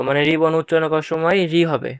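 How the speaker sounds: usual speaking voice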